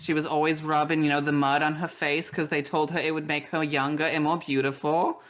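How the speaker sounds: normal talking voice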